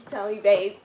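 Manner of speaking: usual speaking voice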